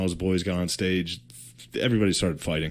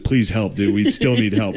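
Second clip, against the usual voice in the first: normal talking voice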